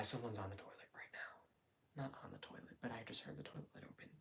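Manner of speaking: hushed whisper so nobody hears; whisper, someone's just behind the wall. Real, not acted. Someone nearby in the bathroom.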